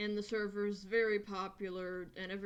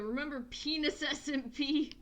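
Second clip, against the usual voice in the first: mock yell; 6 semitones higher, 5 dB louder than usual. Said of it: "And they just yell".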